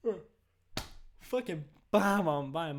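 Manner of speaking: words stretched by a yawn. Real, not acted. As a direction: talk through a yawn. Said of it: "I'm yawning".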